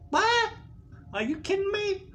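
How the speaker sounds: very high incredulous squawk; mock disbelief. Patient told his blood pressure, high disbelief. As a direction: shoot up high in mock shock.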